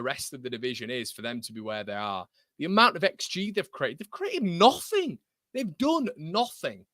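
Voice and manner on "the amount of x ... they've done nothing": high, slow scornful disbelief. Real, not acted. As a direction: sneer at how little they made. Scoffing at Burnley's xG.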